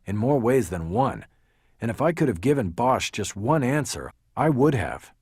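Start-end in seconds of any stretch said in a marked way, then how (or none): none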